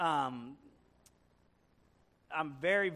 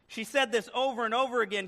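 ordinary talk

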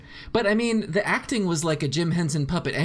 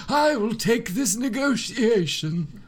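put-on higher, slow elderly voice; old person voice; 5 semitones higher, slower than usual. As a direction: speak like a frail old man. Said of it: "your old person voice".

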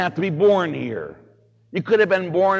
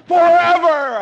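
loud, high yelling, stretching forever; furious shout; 9 dB louder than usual. Retold or imitated. Priest yelling at a truant student, furious shout. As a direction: chase him out screaming. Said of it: "I said".

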